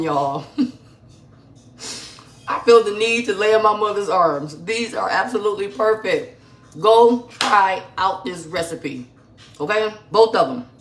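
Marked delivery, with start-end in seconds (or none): 2.5-10.7 higher and louder, gushing with joy; excited raving. Real, not acted. Host gushing to her viewers about the cookies, loud and high. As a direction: rave about them like they're heaven.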